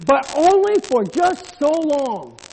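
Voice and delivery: loud, high preacher's cry; full-force preaching. Real, not acted. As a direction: preach it out to the congregation.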